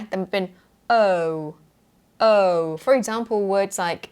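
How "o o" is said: 'O' drawn out, sliding smoothly into a second vowel; gliding British O. Shown as a demonstration. Teacher showing the sound of a diphthong, smooth gliding O. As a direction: start 'O' open, then narrow the lips. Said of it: "sliding into the other very smoothly".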